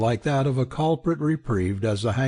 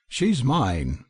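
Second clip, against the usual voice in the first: plain reading voice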